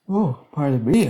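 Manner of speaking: words stretched by a yawn. Real, not acted. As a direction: talk through a yawn. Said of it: "I'm yawning".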